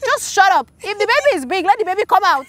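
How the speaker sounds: high, loud, exasperated yell at the midwife; a mother in labor shouting down the midwife, high and loud; retold labor yell. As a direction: snap back in pain, voice up high and loud. Retelling yelling at midwife in labor.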